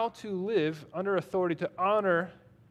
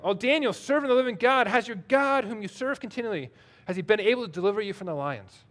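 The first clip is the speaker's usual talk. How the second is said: higher, louder anguished cry that calms toward the end; 4 semitones higher, 4 dB louder than usual. Acted. The king cries out to Daniel in the lions' den, anguished. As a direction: call out desperately to Daniel in the pit. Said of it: "He cries out in a tone of anguish".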